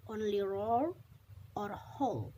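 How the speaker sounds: plain reading voice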